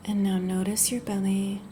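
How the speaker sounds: unhurried, gentle body-scan guidance; guided meditation. Acted. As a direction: ease the listener into rest, phrase by phrase. Leads a guided yoga nidra.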